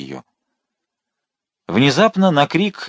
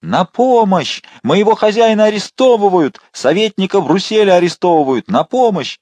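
much higher and louder, calling out the window; piercing cry for help; 10 semitones higher, 5 dB louder than usual. A narrator's reading. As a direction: yell for the whole street to hear. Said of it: "закричала таким пронзительным голосом". (пронзительный крик о помощи)

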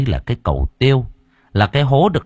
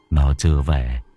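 low gentle reassurance; 7 semitones lower than usual. Acted. Husband gently lies to wife.

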